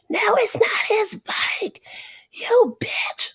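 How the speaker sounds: put-on high, breathy whispered sass from a child; rude little girl. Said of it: "This little girl called me a bitch?".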